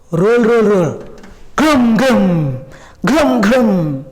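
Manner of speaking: drawn-out rolling grum-grum; humming, falling thunder noises; imitated thunder rumble. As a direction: voice the thunder, letting each boom fall away. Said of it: "some blasting sound will create".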